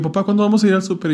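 put-on high, fast childish plea; a kid pestering his dad; whiny kid. Child nagging dad about the supermarket, high and fast.

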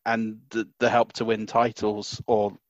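ordinary talk, nothing marked

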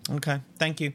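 odd, clipped little thank you; a strangely stiff thank you; weird thank you. Real, not acted. Host thanking the guest oddly, stiff thank you. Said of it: "It was a weird thank you".

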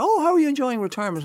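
high, fast chirpy voice imitating people; sing-song mimicry of small talk; mock cheery question. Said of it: "people going".

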